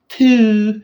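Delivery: high, held note on TWO; drawn-out high TWO. Real, not acted. Fan hyping a sequel, TWO sung out high. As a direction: sing out TWO high and long.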